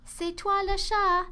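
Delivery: high, loud playground cry; tag, you're it, shouted; exclaiming. A narrator's reading. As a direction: Jada shouting to Anna in a game of tag. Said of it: "s'écria Jada".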